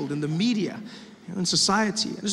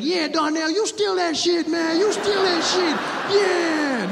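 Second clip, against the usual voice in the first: cheering; 8 semitones higher, slower than usual. Pretend. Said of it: "cheering crime on".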